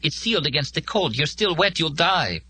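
high-pitched woman's plea to stay; urgent warning. A narrator's reading. Molly tries to stop Joe going out, high and urgent. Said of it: "trying to stop him".

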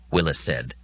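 usual speaking voice

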